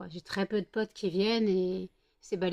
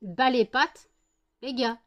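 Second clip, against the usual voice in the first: louder, possessive dog voice; voicing the dog; 3 semitones higher, 5 dB louder, slower than usual. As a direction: speak as a jealous dog guarding her. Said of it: "faut qu'ils montrent".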